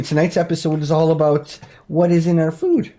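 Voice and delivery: usual speaking voice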